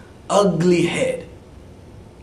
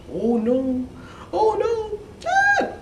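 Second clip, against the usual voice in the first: climbing higher and higher into a squeal; rising panic; slower than usual. Acted. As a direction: panic more with each cry. Princess watches ball sink.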